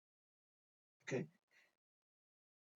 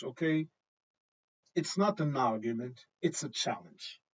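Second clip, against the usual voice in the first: ordinary talk, nothing marked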